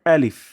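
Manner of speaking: আলিফ clipped and forceful; firm quick alif. Shown as a demonstration. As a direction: snap আলিফ out firmly without stretching. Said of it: "এই সাউন্ডটা একটু শক্ত হবে".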